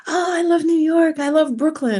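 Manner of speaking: high, loud gushing; delighted kid. Retold or imitated. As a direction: imitate a kid lighting up with excitement. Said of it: "they just brighten up".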